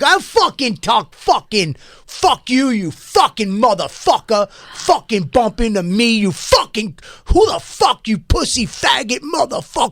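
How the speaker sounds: hammed-up raging stranger, voice climbing; imitated screaming rant. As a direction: scream abuse at the guy who bumped you. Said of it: "he's like".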